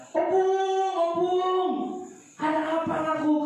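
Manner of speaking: put-on high, eager child's voice calling out; child calling grandma. Child calls Opung, high and eager. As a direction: call up to grandma like a small child.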